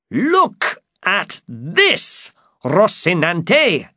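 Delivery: high, slow excited shout; high-pitched storybook knight's shout. A narrator's reading. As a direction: call out grandly, drawing the words out. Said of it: "shouted Don Quixote".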